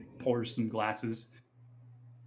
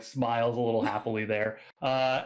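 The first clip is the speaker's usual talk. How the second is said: normal talking voice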